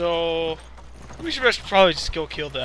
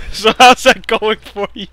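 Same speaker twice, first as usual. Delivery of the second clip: laughing teasing; 3 semitones higher, 10 dB louder, slower than usual. Real, not acted. Teasing partner mid-laugh.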